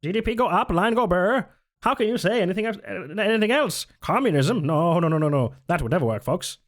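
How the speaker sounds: high, slick pitchman voice; fifties salesman voice. Pretend. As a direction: sell it like a fifties ad man. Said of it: "Like a fifties salesman voice".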